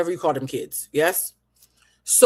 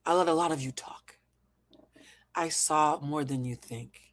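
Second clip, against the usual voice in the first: breathy whispered aside; 2 semitones lower, 6 dB quieter than usual. Acted. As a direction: whisper it like a secret. Repeated line whispered.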